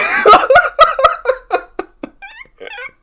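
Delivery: real high-pitched cackle; high burst of laughter. Real, not acted. Laughing out loud at a commenter's insult, high cackle. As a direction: crack up laughing hard.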